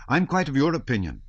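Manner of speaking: eager, high and loud agreement; young hussar eagerly siding with the colonel; flaming up. A narrator's reading. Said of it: "flaming up".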